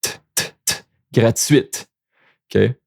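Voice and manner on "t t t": whispered t t t; voiceless t, t, t; isolated t sound. Shown as a demonstration. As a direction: tap out the t sound softly. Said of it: "the T here is not the last letter".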